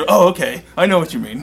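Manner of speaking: light up as the reference clicks; sudden recognition. Retold or imitated. Bandmate gets the band reference.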